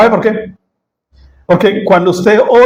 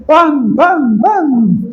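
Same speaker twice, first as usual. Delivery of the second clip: high, ringing echo of the shout; the word repeating as it fades; cave echo; 7 semitones higher, much slower than usual. Shown as a demonstration. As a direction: throw it back like a cave echoing. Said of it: "y la cueva me responde".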